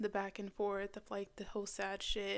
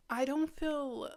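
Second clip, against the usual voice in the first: usual speaking voice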